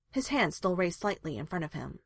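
plain reading voice

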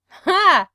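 short loud bark of laughter. A narrator's reading. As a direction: bark out one sharp laugh. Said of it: "Frank barks out a laugh".

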